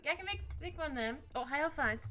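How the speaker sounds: ordinary talk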